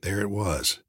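ordinary delivery, nothing marked

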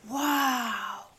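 long, breath-filled wow; awed gasp. A narrator's reading. Max amazed by Wallow's dad, gasping. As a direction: let it out in amazement. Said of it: "gasped Max".

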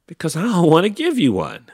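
quick, high, loud comeback; snippy retort. A narrator's reading. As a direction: throw the refusal straight back. Lizard retorts to Hank.

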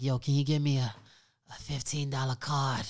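lightly voiced pleading nephew; imitating his nephew. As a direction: mimic how your nephew asked for it. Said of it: "Why you gotta say it like that?".

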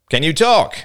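high, loud demand. A narrator's reading. Older boy challenging two silent girls, loudly demanding. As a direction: demand an answer sharply. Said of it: "demanded the older boy".

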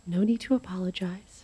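soft reassuring whisper; calm her under your breath. Acted. Medium soothing guest.

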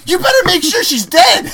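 high, loud, laughing mock order; laughing loud command. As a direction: shout it, cracking up. Ordell retold, laughing.

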